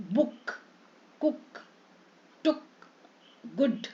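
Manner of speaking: clipped short u in बुक, कुक, टुक, गुड; short u vowel. Shown as a demonstration. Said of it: "है ना, बड़ी ऊ".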